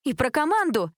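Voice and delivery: high-pitched, slow outraged reproach; indignant. A narrator's reading. Cheer captain to a classmate insulting the team, indignant. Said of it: "возмутилась Джессика".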